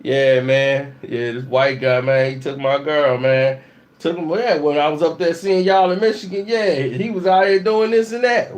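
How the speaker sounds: low mock Raymond on the phone. Raymond calls his cousin from Michigan, low and grumbling. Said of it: "called up his cousin".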